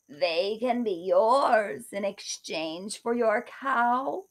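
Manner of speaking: slow, sly beggar voice. Acted. Beggar bargains for the cow, slow sly voice. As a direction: bargain slowly, luring her in. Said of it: "said the beggar".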